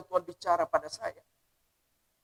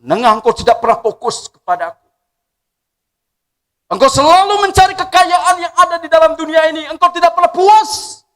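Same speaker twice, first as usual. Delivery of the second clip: loud, high rebuke from the Lord; voice of God; 10 semitones higher, 12 dB louder than usual. Acted. God rebuking Nengah for chasing wealth, booming. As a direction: scold him as God would. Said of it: "Dan Tuhan ingatkan pada saat itu".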